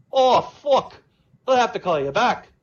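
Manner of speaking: high, loud Irish yelp of alarm; a startled Irishman backing off fast; panicked Irish accent. Acted. Paddy hearing the army size, alarmed Irish accent. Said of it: "Irish and Jamaican".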